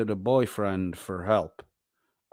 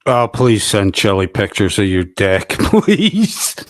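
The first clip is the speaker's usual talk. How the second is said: mocking plea; 3 semitones lower, 9 dB louder than usual. Streamer mocking Chili's photo bounty to the chat, loud sarcastic plea. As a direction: beg it mockingly, laughing at the end.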